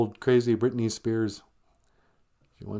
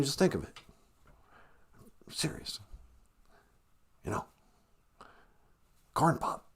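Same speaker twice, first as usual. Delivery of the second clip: put-on hushed old-politician asides rising to a loud threat; Joe Biden; 2 semitones higher, 3 dB louder than usual. Rambling Corn Pop story to listeners, whispery then loud politician impression. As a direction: trail into a whisper, then build to shouting. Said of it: "a Joe Biden impersonation".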